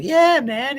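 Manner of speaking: high, put-on voice for the freshly woken man; Lewis Lewis. Retelling the Curb scene, high mock voice. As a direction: squeak it up like a cartoon coma patient. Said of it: "He's like".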